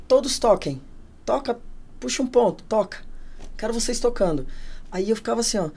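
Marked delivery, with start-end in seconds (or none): 0.1-4.5 raised-pitch, firm orders; imitated command. Spirit guide telling every drummer to play, imitated firm command. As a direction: order the players one short command at a time.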